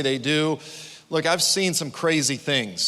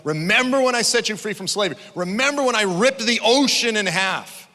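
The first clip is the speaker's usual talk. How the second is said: raised, louder pleading voice of God; urgent call; 5 semitones higher, 3 dB louder than usual. Acted. God pleading with his people, raised and urgent. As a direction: call out to Israel to remember. Preacher voicing God's plea.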